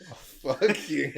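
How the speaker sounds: normal talking voice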